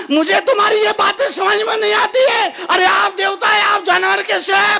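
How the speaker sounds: high, loud protest; baffled wife protesting. Acted. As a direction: object loudly to the husband. (पत्नी का ऊँचा, हैरान विरोध)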